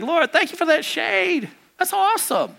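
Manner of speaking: high-pitched, acted-out gratitude; delighted thanks. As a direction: play Jonah gushing with thanks. Acting out a delighted, grateful Jonah.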